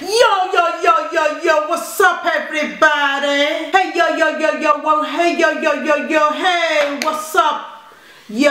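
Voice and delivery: put-on American accent. Mum takes her turn in the challenge, mock American. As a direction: greet everyone in an exaggerated American accent. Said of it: "American, you go first".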